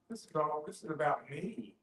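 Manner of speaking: usual speaking voice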